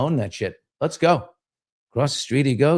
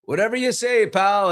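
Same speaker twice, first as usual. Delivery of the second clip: mock-skeptical pals; 9 semitones higher, 3 dB louder than usual. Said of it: "the guys are like".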